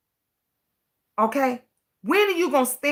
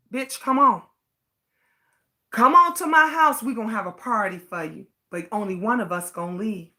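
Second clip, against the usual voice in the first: imitating a menacing threat, dropping softer and fast at the end; threatening quote; 4 semitones lower, 3 dB quieter, faster than usual. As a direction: retell the threat low-key and menacing. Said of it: "I was threatened by".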